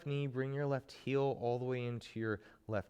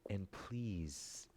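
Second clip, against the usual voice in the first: whispering; 2 semitones lower, 6 dB quieter than usual. Real, not acted. Soft aside during meditation intro.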